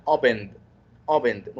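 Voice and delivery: opened ending in a voiced D; teacher models the D ending. Shown as a demonstration. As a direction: say opened as open-d. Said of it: "نطق ال D".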